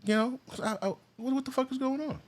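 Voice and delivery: shocked. Real, not acted. Shocked reaction to balloons.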